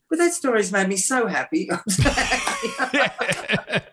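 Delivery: slow, stretched words through real laughter; too amused to finish; laughing with delight. Real, not acted. Delighted by the other's story.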